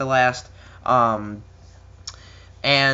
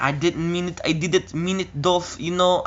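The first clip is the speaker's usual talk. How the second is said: a hint of a Russian accent as Lana pleading; lightly voiced Lana pleading in mock Russian accent; 4 semitones higher than usual. Lana apologising to Dolph, faint Russian accent. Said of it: "I'm trying to do my best Russian accent".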